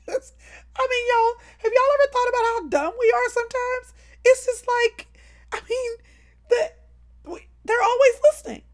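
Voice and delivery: high, loud exasperated rant; high incredulous. Real, not acted. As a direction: squeal it in disbelief.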